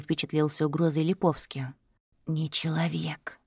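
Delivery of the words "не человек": slow, solemn breathy whisper; whispered singsong. Acted. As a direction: whisper it gravely and slowly. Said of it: "не менее торжественным тоном".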